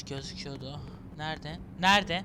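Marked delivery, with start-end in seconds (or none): 1.8-2.2 calling out louder. Real, not acted. Player calling out for the lost friend, louder. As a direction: call Nerede out across the room. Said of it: "Nerede? repeated louder".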